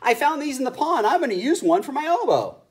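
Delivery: high, loud duck voice. A narrator's reading. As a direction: quack it out as a duck.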